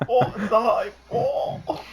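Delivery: high-pitched, hammed-up excitement; enthusiastic ghost. Showing a livelier game ghost, enthusiastic mock cry. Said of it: "It'd be more enthusiastic".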